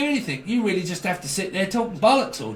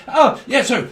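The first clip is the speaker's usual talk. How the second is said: eager jump-in; 6 semitones higher, 7 dB louder than usual. Retold or imitated.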